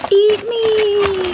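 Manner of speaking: very high, loud pretend scream; high-pitched comic shriek from the pork chops. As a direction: scream it as talking food, drawn out. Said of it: "they're kinda screaming at me".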